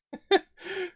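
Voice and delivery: short high-pitched giggle; amused little squeal of laughter; quick, high tickled laugh. Real, not acted. Laughs at game's words.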